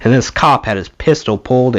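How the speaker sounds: ordinary delivery, nothing marked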